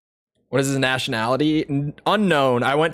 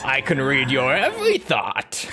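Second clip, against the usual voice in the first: Psycho Mantis impression. Streamer echoes the villain's line from the game, theatrical imitation. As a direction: mimic a mind-reading game villain.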